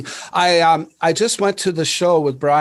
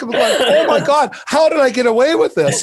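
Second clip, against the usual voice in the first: giddy laughing relief; laughing disbelief; 7 semitones higher, 4 dB louder than usual. Retold or imitated. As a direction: laugh through it in amazement. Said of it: "I was like".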